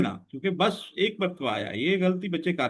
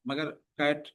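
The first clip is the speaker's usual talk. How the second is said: ordinary delivery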